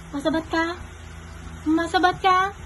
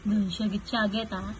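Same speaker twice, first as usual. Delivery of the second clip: low, soft hushing; quiet hush; 7 semitones lower, 7 dB quieter than usual. Real, not acted. Parent telling baby not to shout, low quiet hush.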